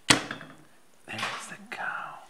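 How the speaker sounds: slow playful whisper. Acted. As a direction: whisper it like a secret to a toddler. Parent whispering to toddler.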